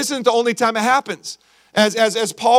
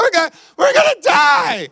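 panicked shout; 9 semitones higher than usual. Acted. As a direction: yell it like a castaway sure of doom. Panicked castaway in joke.

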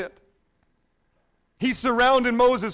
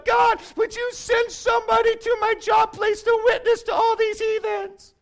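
whiny pleading prayer; 11 semitones higher than usual. Retold or imitated. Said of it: "we find ourselves".

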